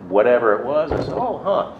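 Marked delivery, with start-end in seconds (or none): 1.1-1.7 feigned interest. Retold or imitated. Playing innocent at the bar.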